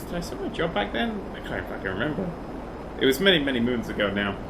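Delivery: ordinary talk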